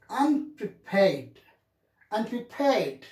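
"Unprepared" with main weight on "-pared"; teacher drilling "Unprepared", strong last syllable; primary and secondary stress. Shown as a demonstration. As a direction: model "Unprepared" as un-pre-PARED. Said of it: "I should say".

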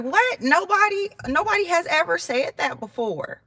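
high incredulous protest; disbelieving host. Retold or imitated. Host on phone insisting nobody complained before, high disbelieving. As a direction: sound shocked that anyone could complain. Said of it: "She was like".

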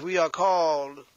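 ordinary talk, nothing marked

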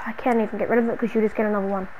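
usual speaking voice

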